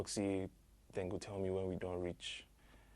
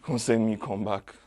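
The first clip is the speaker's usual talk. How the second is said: anguished outcry; 5 semitones higher, 7 dB louder than usual. Acted. Anguished account of torture.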